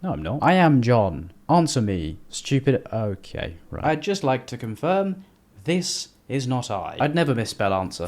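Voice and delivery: ordinary delivery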